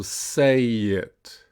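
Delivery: slow "say it" joined by a y glide; "say it" as one slow, unbroken word; linked practice model. Shown as a demonstration. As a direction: draw out "say it" as say-yit. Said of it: "So let's practice that".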